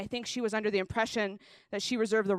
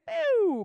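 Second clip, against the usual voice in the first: mock boo; 12 semitones higher, 6 dB louder than usual. Booing herself.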